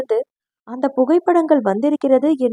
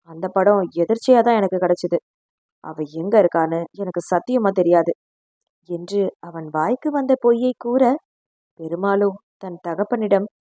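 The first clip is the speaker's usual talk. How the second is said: plain reading voice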